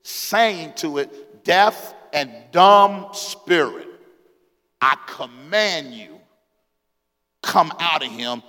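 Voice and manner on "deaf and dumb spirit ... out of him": slow, measured command to a spirit; commanding exorcism, heavy pauses between phrases; stern rebuke. Acted. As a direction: order it out word by word, slowly. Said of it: "he rebuked the unclean spirit".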